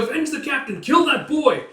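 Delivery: very high, loud vengeful yell; rally the men for revenge; furious soldier; enraged shout. Acted.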